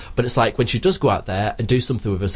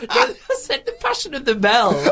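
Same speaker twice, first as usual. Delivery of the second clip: high, slow, laughing correction; high squeaky mock protest; mock indignant; 12 semitones higher, slower than usual. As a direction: pretend to insist, giggling. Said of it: "I thought you said".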